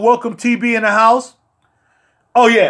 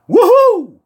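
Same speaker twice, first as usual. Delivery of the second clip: excited whoop; 11 semitones higher, 5 dB louder than usual. Real, not acted. Cheering after joke.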